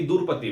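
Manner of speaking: rustic durpati for Draupadi; village pronunciation. Retold or imitated. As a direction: imitate the village way: दुरपति, with the vowel dropped in. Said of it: "दुरपति बोलते हैं गांव में".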